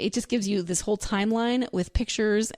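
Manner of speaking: normal talking voice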